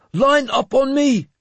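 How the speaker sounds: high, loud rallying order; shouted command. A narrator's reading. Warrior with banner forming the line, shouting. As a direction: shout it so the whole line hears. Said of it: "he shouted".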